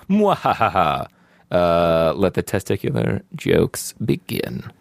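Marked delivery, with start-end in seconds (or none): 0.1-1.1 slow mock evil laugh; sneering panto villain. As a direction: ham up a villain cackle. Villain laugh in read review.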